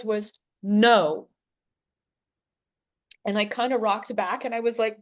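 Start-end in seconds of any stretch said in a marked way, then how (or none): 0.6-1.3 a loud, firm no, not yelled. Retold or imitated. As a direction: say it like a final command. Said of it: "not yelled, but it was loud ... firm".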